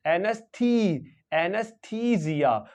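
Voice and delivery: "एनेस्थीसिया" slowly, with a long "थी"; long E demo. Shown as a demonstration. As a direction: stretch the "थी" in "एनेस्थीसिया", falling at the end. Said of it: "long ई pronounce कर देंगे".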